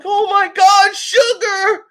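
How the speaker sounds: high, loud shocked shout; shocked cry. Retold or imitated. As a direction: cry out her name in alarm. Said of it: "He's like".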